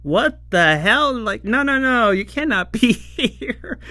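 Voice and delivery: flustered, half-laughing "no, no"; imitating Hotaru shooing the dragon away; panicked protest. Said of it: "And then Hoto's like".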